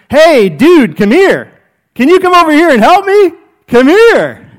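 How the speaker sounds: loud, high calls across the yard; yelling to call someone over. Retold or imitated. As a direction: shout for the worker to come over. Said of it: "I can keep yelling at the person".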